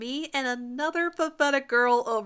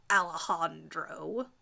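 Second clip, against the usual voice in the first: venomous; 5 semitones lower, 5 dB quieter, slower than usual. Acted. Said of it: "the venom she spits into saying my name".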